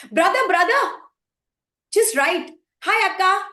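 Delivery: high, loud, insistent call; eager calling out. Acted. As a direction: call brother over to take dictation. Patient eagerly dictating to brother.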